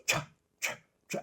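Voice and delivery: whispered count-in. Retold or imitated. Imitating guitarist's scratch count.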